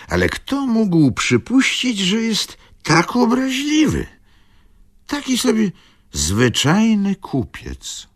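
put-on high, slowing old man's complaint; puzzled, a touch offended; baffled old father. A narrator's reading. Tomasz complains of the merchant, high and slowing.

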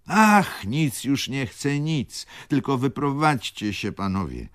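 the baroness begs the lodgers to leave, in a high, exclaiming voice; baroness calling out. A narrator's reading. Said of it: "zawołała baronowa".

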